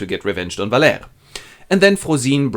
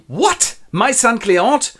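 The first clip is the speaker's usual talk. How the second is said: high, startled outburst; shocked father; 11 semitones higher, 4 dB louder, slower than usual. Acted. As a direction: react in high-pitched disbelief. Father discovers borrower is son.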